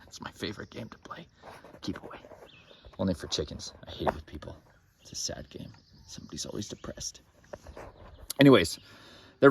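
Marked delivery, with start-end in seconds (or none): 0.1-7.2 hushed whisper, barely voiced; whispered aside to the chickens. Real, not acted. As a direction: drop to a secret whisper.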